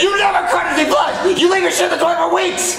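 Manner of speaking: outraged shouting. Acted. Donkey accuses Shrek over his flushing, outraged shouting. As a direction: fling the accusation back, yelling. Acted quarrel about flushing.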